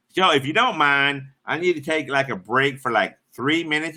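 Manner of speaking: ordinary delivery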